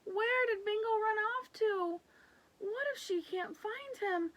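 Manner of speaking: put-on high anxious little girl voice; worried child. Lulu fretting over her lost dog, high and worried. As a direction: fret like a scared child.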